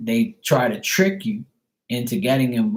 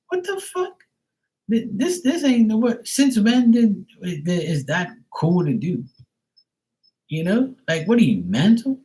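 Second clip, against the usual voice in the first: incredulous outburst; 8 semitones higher than usual. Retold or imitated. Incredulous retort to picture request.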